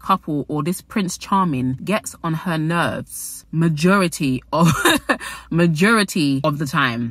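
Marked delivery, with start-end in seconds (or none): 4.7-5.4 laugh. Real, not acted. Laughing mid-sentence.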